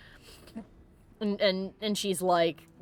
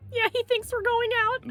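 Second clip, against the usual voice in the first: high-pitched squeak; amused dismay; 11 semitones higher than usual. Real, not acted. Gamer watching the awkward date, high amused dismay. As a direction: squeak it in playful alarm.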